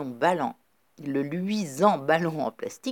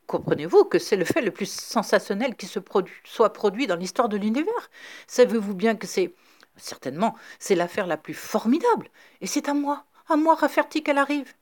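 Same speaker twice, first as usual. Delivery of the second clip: voice cracking with excitement; 6 semitones higher, 5 dB louder than usual. A narrator's reading. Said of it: "sa voix déraillait".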